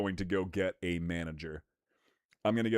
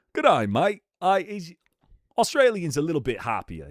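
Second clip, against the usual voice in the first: higher, louder, cheery Australian accent; 6 semitones higher, 4 dB louder than usual. Shown as a demonstration. Streamer comparing accents for chat, bouncy Australian accent. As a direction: lean into a happy Aussie accent. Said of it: "slightly lean into Australian".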